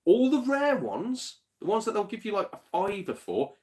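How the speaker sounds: high, slow indignant outrage; mock-outraged high voice; indignant rise. Mocking the clerk's choices.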